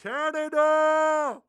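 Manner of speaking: high, drawn-out shout; mock crowd cheer. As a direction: stretch it into a big hammed-up holler. Crowd reacting to imagined roast burn.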